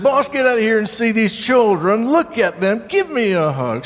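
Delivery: high, eager calling out; excited grandmother. Retold or imitated. Grandmother calling her husband to see the children, high and excited. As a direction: call the husband out, bursting with joy. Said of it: "She was so excited".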